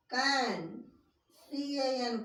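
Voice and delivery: normal talking voice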